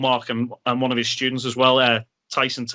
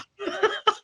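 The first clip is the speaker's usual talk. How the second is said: high laugh. Real, not acted. Laughing after the impression.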